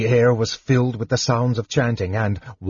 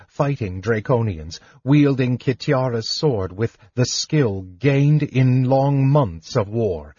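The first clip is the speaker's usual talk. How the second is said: usual speaking voice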